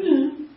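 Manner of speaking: high, offhand mm; a shrugging little hum; dismissive hum. Retold or imitated. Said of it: "with a little bit of".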